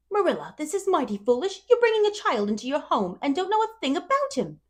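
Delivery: tut-tut at a foolish plan; scolding neighbour. A narrator's reading. Rachel scolding Marilla about the adoption, disapproving.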